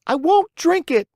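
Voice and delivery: put-on very high, loud puppet boy refusal; crying out. A narrator's reading. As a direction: scream the refusal at the fairy. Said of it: "cried Pinocchio".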